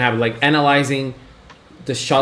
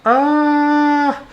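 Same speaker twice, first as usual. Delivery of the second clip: rising into a high squeal; overwhelmed with anticipation, voice climbing; fangirl freak-out; 11 semitones higher than usual. Real, not acted. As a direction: build up to a long, high "ah".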